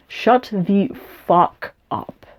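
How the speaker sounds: slow, each word spaced out; slow emphatic repeat. Real, not acted. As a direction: drag every word out, dead serious. Repeating insult, slowed for effect.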